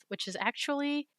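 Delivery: normal talking voice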